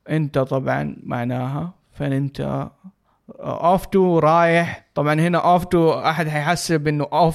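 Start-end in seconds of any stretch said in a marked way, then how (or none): none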